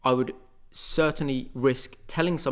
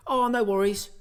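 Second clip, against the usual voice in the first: mock happy; 8 semitones higher than usual. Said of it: "be all happy and go".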